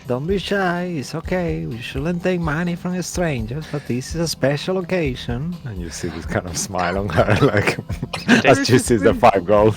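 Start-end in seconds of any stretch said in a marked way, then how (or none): 0.1-5.6 high, soft motherly coaxing; mother's voice. Acted. Mother telling her daughter to accept gold, soft high coaxing. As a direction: gently encourage a shy child. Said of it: "you hear the mother saying".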